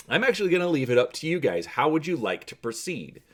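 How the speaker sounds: usual speaking voice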